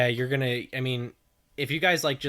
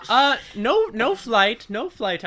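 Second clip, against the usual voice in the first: high, loud put-on radio character; 12 semitones higher, 10 dB louder, slower than usual. Radio turns down the players' flying hopes, high radio voice. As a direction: let them down in a high radio voice.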